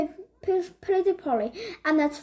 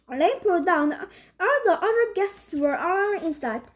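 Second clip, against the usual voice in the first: plain reading voice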